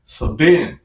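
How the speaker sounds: normal talking voice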